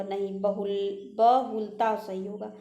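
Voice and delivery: usual speaking voice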